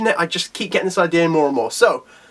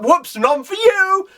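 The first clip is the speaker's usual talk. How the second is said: high, drawn-out playful jeer; mocking taunt; 12 semitones higher, much slower than usual. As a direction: tease them in a singsong, stretching the words. Character snatches the cells, taunting.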